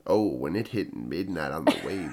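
very low, soft midnight DJ; late-night radio voice. Shown as a demonstration. Podcaster demonstrating midnight radio shift to co-host, deep sultry voice. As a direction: drop deep and smooth for the midnight airwaves. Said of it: "Is that your radio voice?".

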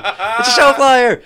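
high, loud, drawn-out whoop; hammed-up thrilled yell; mock excited shout. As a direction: crow it like a huge discovery. Mimics friend's excited reveal.

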